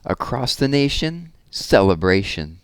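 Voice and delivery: lively, sing-song read-aloud of a rhyming book; reading to children. Acted. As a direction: read it with bounce for the little ones. Reads rhyming rocket picture book.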